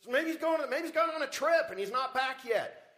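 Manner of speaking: high, loud sneering jab; gloating mockery. Elijah mocking the priests of Baal, loud high gloating. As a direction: rub it in with a loud jeer. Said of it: "Is he gloating? A little bit".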